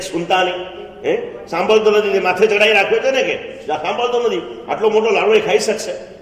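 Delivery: loud, high angry complaint; angry father complaining to mother about stubborn son. Acted. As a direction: vent at the mother about the boy. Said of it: "फिर पिताजी जरा गुस्से में".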